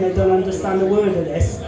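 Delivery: usual speaking voice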